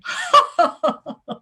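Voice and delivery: loud, high mock cackling; short burst of gloating stage laughter; hammed-up laughter. Operatives amused by those Americans, hammed-up laughter. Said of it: "go home at night laughing".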